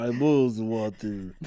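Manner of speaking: slow, badly faked Russian accent; half-hearted slow Russian accent; attempted Russian accent. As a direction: try Russian and slide toward French. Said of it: "Do this in a Russian accent".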